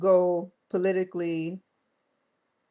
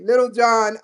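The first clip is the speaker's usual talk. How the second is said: normal talking voice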